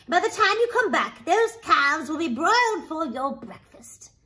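high, brisk-mannered coaxing of a husband; bustling ogre's wife. Acted. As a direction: chivvy him off to wash, voice lifted.

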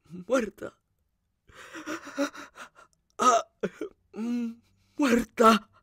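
high, stunned grief with moans; grief-stricken disbelief; anguished exclamation. A narrator's reading. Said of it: "exclamó".

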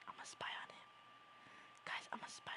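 hushed spy whisper, barely voiced. Streamer spying on his pet, whispering. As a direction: whisper it like you're hiding from him.

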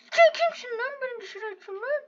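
high, half-crying indignant complaint; whining outrage. Real, not acted. Gamer thrown out of the ring, whining outrage. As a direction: whine in outrage.

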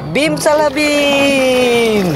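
slow, drawn-out magic chant; slow showman's magic spell; kids party magician. Pretend. Magic word while revealing toy.

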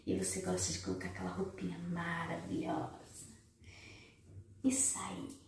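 soft teasing whisper. Real, not acted. Speaker teasing women who dress up, whispered. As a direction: let it slip out as a whisper.

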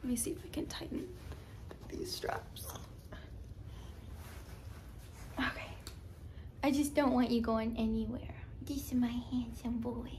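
soft hushed whisper. Real, not acted. Whispering near baby.

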